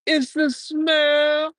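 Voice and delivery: high, slow "it's the smell"; The Rock impression. Streamer imitating a wrestler's catchphrase, slow and high. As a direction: drag it out like the wrestler.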